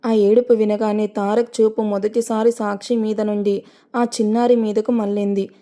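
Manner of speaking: ordinary delivery, nothing marked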